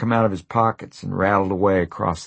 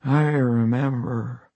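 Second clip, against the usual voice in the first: slow, wet, husky voice; 4 semitones higher, slower than usual. Acted. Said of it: "in a wet and husky voice".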